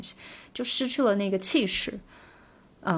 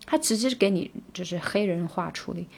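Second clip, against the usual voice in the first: ordinary delivery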